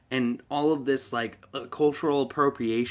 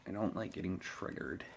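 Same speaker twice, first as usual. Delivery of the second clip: ordinary talk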